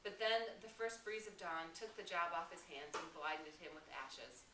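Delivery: reading a novel aloud to the class; steady classroom read-aloud voice; teacher reading a chapter to students; story narration. Acted.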